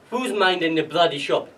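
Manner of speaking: loud, blunt quoted question; gruff demand. Retold or imitated. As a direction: demand it like a swearing father. Father's swearing first words, retold.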